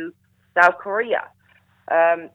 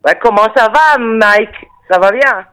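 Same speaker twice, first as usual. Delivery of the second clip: loud, bright French hello; cheery French greeting; 5 semitones higher, 10 dB louder than usual. Shown as a demonstration. Greeting the radio host in French, loud and cheerful. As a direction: call out the French greeting with a grin. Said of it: "give us a sentence or two".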